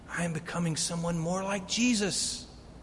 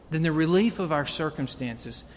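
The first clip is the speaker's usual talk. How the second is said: ordinary delivery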